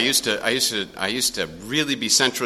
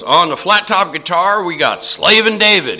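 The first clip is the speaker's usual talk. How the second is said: louder, higher stage-announcer call; band introduction; 7 semitones higher, 8 dB louder than usual. Retold or imitated. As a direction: announce him to the crowd like an MC. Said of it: "Hook goes".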